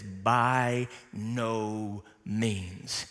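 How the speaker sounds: very slow, low, every word weighted; emphatic no. Retold or imitated. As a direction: draw it out, final and firm. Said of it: "the most emphatic no that he can".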